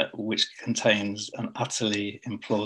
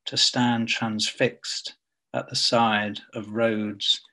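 measured poem recital with long pauses between lines; poetry reading; 5 dB louder than usual. Acted. Poet reading to an online audience, measured poetry reading. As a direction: read it line by line, letting each phrase hang.

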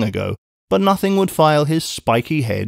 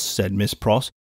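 usual speaking voice

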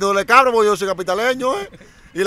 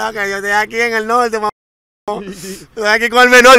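bragging phone call; 4 semitones higher, 8 dB louder than usual. Retold or imitated. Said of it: "Lo llamaba cada rato".

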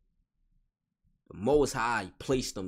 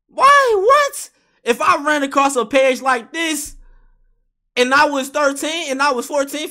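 excited shouting; 14 semitones higher, 12 dB louder than usual. Real, not acted. Escalating rant about awakening people.

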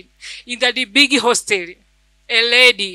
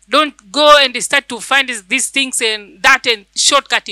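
urging the congregation, rising and louder; preaching; 4 dB louder than usual. Acted. Preacher urging the congregation to trust God, full preaching. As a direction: press the promise home like a pulpit appeal.

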